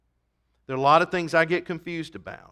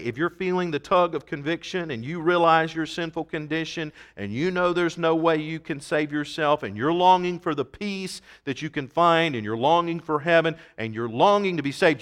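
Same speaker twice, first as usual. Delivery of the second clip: ordinary delivery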